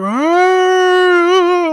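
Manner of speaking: high loud mock wail of a ghost; imitated ghost scream. A chained ghost bursting in, mock ghostly scream. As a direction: belt out a short ghostly scream. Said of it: "him screaming like".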